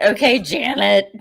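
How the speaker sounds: dismissive okay. Retold or imitated. Mocking a coworker named Janet.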